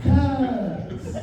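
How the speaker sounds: a light, stretched mock cuz; imitating cousin's drawn-out cuz. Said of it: "I don't know why he gotta say it".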